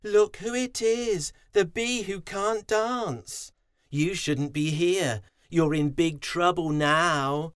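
sneering bullies. A narrator's reading. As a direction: taunt the bee who can't dance. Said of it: "the other bees sneered".

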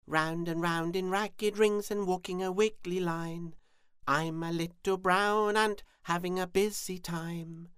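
high, loud chanting ant voice; busy little ant. A narrator's reading.

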